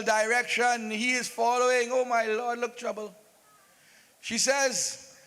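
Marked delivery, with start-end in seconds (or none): none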